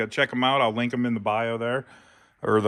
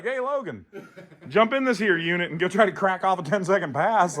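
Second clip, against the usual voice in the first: lightly acted dad calling to his kid, higher pitched; mock casual parent; 6 semitones higher than usual. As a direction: toss out a reckless invitation in mock-cheerful tones. Voices himself urging his kid on.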